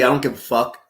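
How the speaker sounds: ordinary talk, nothing marked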